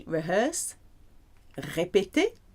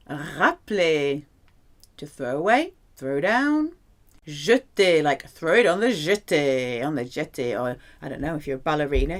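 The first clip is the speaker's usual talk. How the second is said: ordinary talk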